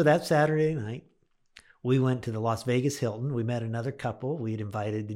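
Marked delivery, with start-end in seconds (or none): none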